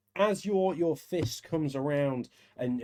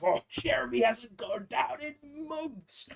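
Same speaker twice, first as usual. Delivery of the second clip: impressed tavern patron; 10 semitones higher, slower than usual. Acted.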